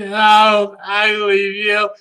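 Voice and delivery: hammed-up high, loud wailing sob; mock sobbing. Rarity crying that she's hideous, hammed-up wailing sob. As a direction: wail it like an overdramatic fake cry.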